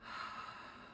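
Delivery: long airy sigh out through the mouth; healing-sound exhale. Shown as a demonstration. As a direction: let a breathy exhale slowly fade away. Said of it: "use one of the healing sounds".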